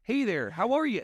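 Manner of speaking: high, sing-song mock Minnesota greeting; Minnesota accent. Buddy mocks a northern Midwest accent, in a high Minnesota lilt. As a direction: chirp it like a friendly Minnesotan. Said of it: "It's like it's Canadian".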